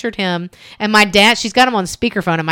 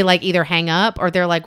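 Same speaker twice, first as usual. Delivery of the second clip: ordinary delivery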